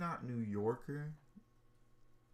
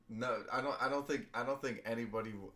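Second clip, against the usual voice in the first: ordinary delivery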